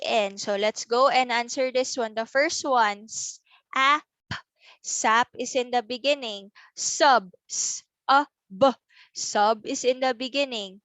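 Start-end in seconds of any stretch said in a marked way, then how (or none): 3.1-4.0 "s-, ap" split into a held s, then ap; sounding out. Shown as a demonstration. Teacher sorting sounds for children, sounding out "s-, ap". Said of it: "our sound hound". 7.5-8.7 "s-, a, b" as three separate letter sounds; sounding out. Shown as a demonstration. Teacher breaks down sub, sounding out "s-, a, b". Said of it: "our sound hound".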